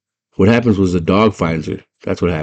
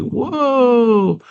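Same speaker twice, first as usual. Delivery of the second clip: very high, drawn-out trippy whoa; hammed-up dazed wonder; mock amazed. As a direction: float it up high, entranced. Tripped-out girl marvels in retelling.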